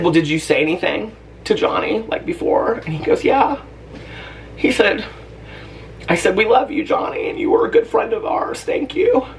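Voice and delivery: high, tearful voice breaking up; choked up, pitch climbing as the crying takes over. Real, not acted. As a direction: fight through real tears to get the words out. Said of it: "I'm gonna start crying talking about this".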